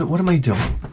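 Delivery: ordinary talk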